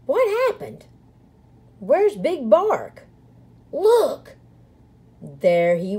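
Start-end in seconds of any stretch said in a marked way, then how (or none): 0.1-4.4 high, alarmed calling out; startled. A narrator's reading. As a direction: call out high in sudden surprise. Prairie dogs startled, searching.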